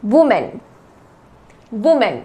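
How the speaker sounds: वुमेन as "wu-min" with a short, weak ending; teacher drilling वुमेन, "wu-min" not "man"; model pronunciation. Shown as a demonstration. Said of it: "इसका उच्चारण होगा".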